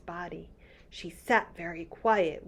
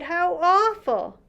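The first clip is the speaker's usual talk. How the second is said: put-on high, shocked little-girl cry; a child horrified at the plant's pain; cried out; 11 semitones higher, 6 dB louder than usual. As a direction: gasp it out in dismay. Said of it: "Sophie cried".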